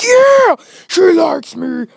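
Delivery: high, loud triumphant yell; triumphant shout. Retold or imitated. Told she likes him, loud high gloating shout. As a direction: crow it out loud in victory.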